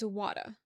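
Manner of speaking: "water" as wadda, ending in an open uh; New York water. Shown as a demonstration. Streamer corrects viewers on mom's word, New York wadda. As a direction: end "water" on uh, no r. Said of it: "W A D D A".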